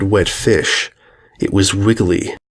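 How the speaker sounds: ordinary delivery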